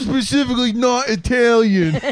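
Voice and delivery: mock Angelo. Playing Angelo denying Italian.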